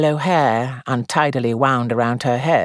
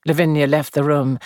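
usual speaking voice

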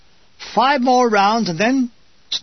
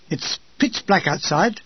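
plain reading voice

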